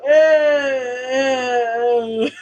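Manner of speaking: drawn-out noncommittal groans, half laughing; imitated crowd reaction. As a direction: mimic a room of unconvinced diners. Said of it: "And they go".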